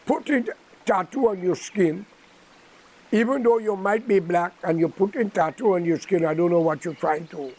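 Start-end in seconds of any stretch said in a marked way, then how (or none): none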